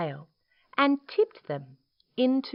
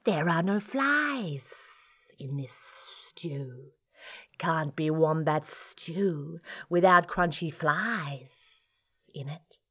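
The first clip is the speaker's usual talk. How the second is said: sly lizard with long hissed s; hissing lizard. Acted. Lizard complaining to Dingo about flies, hissing. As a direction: draw out each s like a lizard. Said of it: "he hissed".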